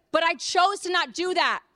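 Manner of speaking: usual speaking voice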